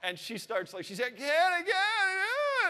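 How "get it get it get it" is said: put-on high, pleading little girl cries; imitating desperate child. Daughter begging dad on the toilet, high desperate pleading. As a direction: beg like a frantic toddler in pain.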